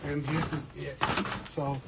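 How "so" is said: usual speaking voice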